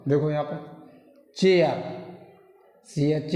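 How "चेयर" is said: चेयर said clearly as "chay-ar"; teacher modelling चेयर with an "ay" vowel; model pronunciation. Shown as a demonstration. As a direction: lean on the "ay" in चेयर. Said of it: "तो भी ए का उच्चारण ए होगा".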